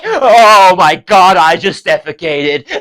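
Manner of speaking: loud, high, drawn-out gushing; overwhelmed fan. Acted. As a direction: react as if floored with delight. Delighted fan reacts to the character.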